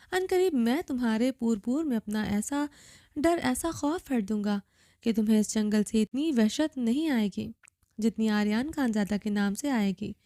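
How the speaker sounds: usual speaking voice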